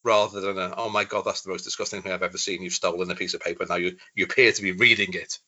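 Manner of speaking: ordinary talk, nothing marked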